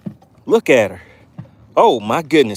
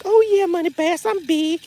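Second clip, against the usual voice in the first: high falsetto of a big-boned fish; talking fish; 12 semitones higher than usual. Acted. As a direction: plead the fish's case in a high voice. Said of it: "She's like".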